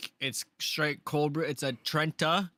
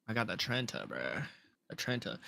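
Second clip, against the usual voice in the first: normal talking voice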